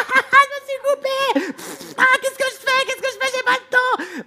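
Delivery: high, loud panicked shrieking; hammed-up frantic screaming; stressed-out panic. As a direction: play a cook losing it completely. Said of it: "ils se stressent comme des tarés".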